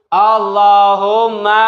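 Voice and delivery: very slow, drawn-out chant; leading recitation. Shown as a demonstration. Teacher starting pupils on the sholawat, slow chant. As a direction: hold each vowel as a cue.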